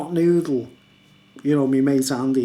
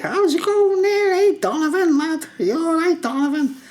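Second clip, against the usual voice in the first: high laughing squeaky mock voice; mocking weird guy; 13 semitones higher than usual. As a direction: squeal the name like a pest. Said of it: "he's going like".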